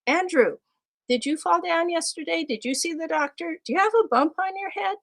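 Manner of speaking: high-pitched playful questioning; mock-concerned teacher questions; teasing questions. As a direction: pretend to take his excuse seriously. Teacher quizzing a student playfully.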